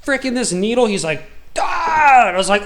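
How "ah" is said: sharp, much higher, louder mock yell; retold cry of surprise, far higher and louder; imitated yell. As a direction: yelp it out like the doctor did. Doctor jabs needle, both yell.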